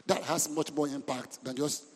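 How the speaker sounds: normal talking voice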